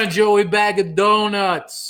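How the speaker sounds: high, loud, drawn-out Italian accent; belted-out Italian-American voice. Shown as a demonstration. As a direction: ham up an Italian-American accent, big and slow. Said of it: "So many ways you can do the Italian voice".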